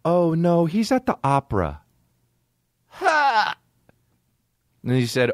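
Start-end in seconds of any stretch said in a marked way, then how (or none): 0.0-1.8 high, put-on mom voice; imitating his mom. Faking his mom on the phone, high and prim. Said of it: "I literally said". 2.9-3.5 sharp laugh. Real, not acted. Bursting laugh at own story.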